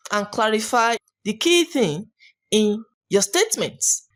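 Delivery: normal talking voice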